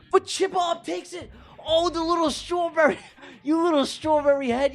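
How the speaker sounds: high race call building to shouts; excited race call. Real, not acted. Streamer calling the race finish, excited shouting.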